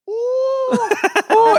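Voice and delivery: very high squealing ooh ooh; swooning girls. Retold or imitated. Girls fawning over a lookalike, squealed very high. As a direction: squeal like a star-struck fangirl. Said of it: "girls like".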